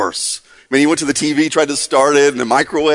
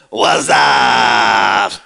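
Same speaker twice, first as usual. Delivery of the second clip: catchphrase imitation; 8 semitones lower than usual. Speaker mimicking a famous ad for the crowd, shouted catchphrase. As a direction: holler the ad catchphrase low and long. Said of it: "remember this one?".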